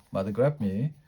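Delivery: ordinary delivery, nothing marked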